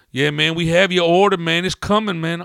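loud, high counter worker; harried counter guy. Acted. Counter guy promising the order is coming, loud and high. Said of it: "the other guy behind the counter was all".

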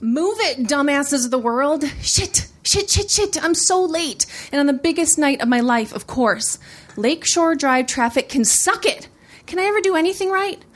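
high-pitched, frantic exasperation; acted-out stressed driver's rant; frantic road rage. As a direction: curse the traffic in a late-running panic. Opening monologue, stuck in traffic, late.

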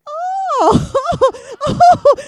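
very high, loud drawn-out "oh"; mimicked high hooting "oh"; imitated crowd "oh". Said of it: "everybody in the room went".

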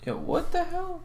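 high squeaky disbelief; shocked. Real, not acted. Watching a scary video, startled high-pitched squeal.

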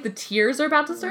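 ordinary talk, nothing marked

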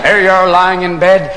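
usual speaking voice

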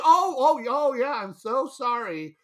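high, loud embarrassed oh; imitating her rushing to apologize; flustered apology. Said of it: "She said".

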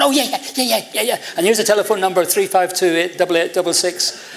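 high-pitched, rattled-off imitation of a keen friend; eager agreement. Friend on the phone lends his mum's shop, eagerly. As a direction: leap up high on a run of yeahs.

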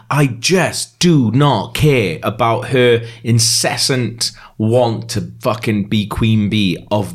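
slow, word by word on "I just do not care"; weary rant, spacing out "I just do not care"; exasperated emphasis. Real, not acted. Ranting about character's ambition.

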